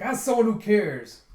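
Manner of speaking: high, loud dismissive retort; mock exasperation. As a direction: brush off the flirt with a mock yell. Brusque retort to flirting.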